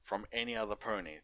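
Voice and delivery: ordinary delivery, nothing marked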